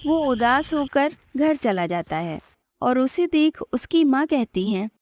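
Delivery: ordinary delivery, nothing marked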